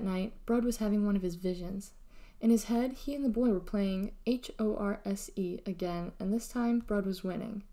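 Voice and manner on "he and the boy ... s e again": plain reading voice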